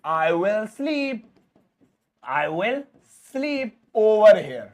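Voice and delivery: "I will sleep over here" stretched right out; slow teacher read; very slow model sentence. Shown as a demonstration. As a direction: dictate it slowly for learners. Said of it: "जैसे मैं यहीं सोऊंगा".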